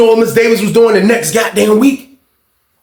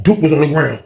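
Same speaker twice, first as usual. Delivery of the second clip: usual speaking voice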